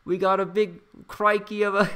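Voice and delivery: high-pitched, hammed-up Aussie wildlife host; terrible Australian accent. Livestream host fumbling slang about a falcon, terrible Australian accent. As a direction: put on a badly faked Australian accent, way up high. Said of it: "my terrible Australian accent".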